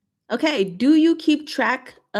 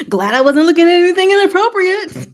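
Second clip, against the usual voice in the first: much higher and louder, laughing relief; embarrassed laugh; 12 semitones higher, 10 dB louder than usual. Real, not acted. Streamer relieved on camera, embarrassed laugh. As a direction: giggle it out, relieved.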